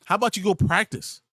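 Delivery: ordinary delivery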